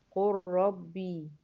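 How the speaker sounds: قل ربي very slow, as "qur-rabbii"; no L in قل, merge it into ربي, drawn out; merged lam into ra. Shown as a demonstration. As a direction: stretch the doubled R and final vowel of قل ربي. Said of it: "وهكذا".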